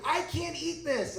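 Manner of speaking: high, drawn-out mock whine; whining child. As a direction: imitate your young son whining. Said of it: "he starts whining and literally crying".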